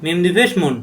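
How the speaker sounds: normal talking voice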